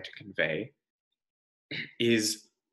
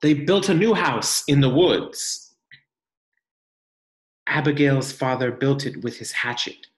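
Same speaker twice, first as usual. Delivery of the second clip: gentle, expressive picture-book reading; reading to children; 4 semitones higher, 6 dB louder than usual. Acted. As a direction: read it like a storybook for the class.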